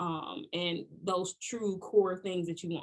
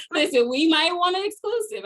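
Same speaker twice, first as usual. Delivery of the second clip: excited teasing; 8 semitones higher, 9 dB louder than usual. Real, not acted. Host teasing for news.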